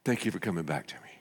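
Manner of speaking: soft, rushed murmur; lightly voiced sleepy God; quiet and quick. God voiced, then back asleep.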